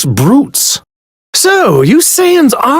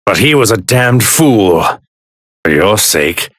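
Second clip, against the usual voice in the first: ordinary delivery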